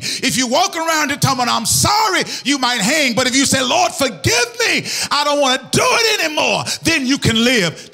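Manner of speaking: full-voiced preaching, rising on key phrases; impassioned preaching. Real, not acted. Preacher urging congregation to repent, impassioned. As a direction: drive the message home to the congregation.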